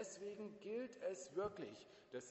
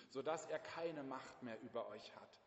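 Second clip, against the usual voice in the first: ordinary talk, nothing marked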